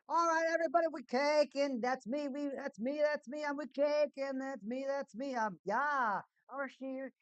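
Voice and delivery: high, loud hype greeting; hype intro. Real, not acted. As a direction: hype up the stream.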